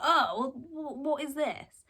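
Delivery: imitated appalled stammer; disgusted recoil. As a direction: recoil in retold disgust, stumbling on the words. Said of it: "Robert is just disgusted and angry".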